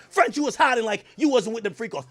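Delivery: ordinary talk, nothing marked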